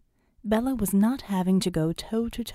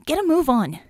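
loud, high, tense urging; 7 semitones higher, 7 dB louder than usual. Acted. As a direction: push them to hurry. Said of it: "Vicky said tensely".

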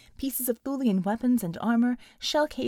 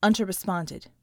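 ordinary delivery, nothing marked

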